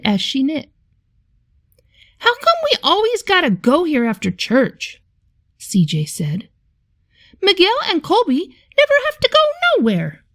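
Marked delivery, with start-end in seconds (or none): 2.2-5.0 put-on whiny boy. Boy complains to Nana. 7.4-10.2 put-on whiny boy. Boy complains to Nana.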